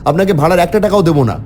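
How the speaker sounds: high, fast angry threat. A narrator's reading. Traveler threatening the cart driver, angry and fast. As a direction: snap at the driver in anger. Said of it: "আমি রেগে গিয়ে বললাম".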